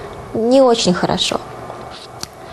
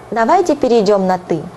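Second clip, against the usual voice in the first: quick Russian. Shown as a demonstration. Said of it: "faster repeat".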